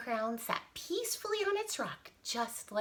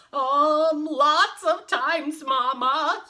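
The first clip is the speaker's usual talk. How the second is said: put-on high, loud, drawn-out little-boy plea; whiny, stretched-out confession; sheepish giant son; 7 semitones higher, 10 dB louder, much slower than usual. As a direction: answer Mama like a scolded boy. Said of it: "he whispered".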